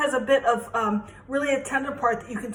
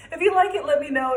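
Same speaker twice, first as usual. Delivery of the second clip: ordinary delivery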